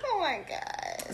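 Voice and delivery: high, slow, hushed oh my God; stunned oh my God. Real, not acted. Friend reacting to the rug story in hushed disbelief. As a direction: marvel at it, quietly.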